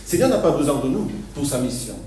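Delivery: normal talking voice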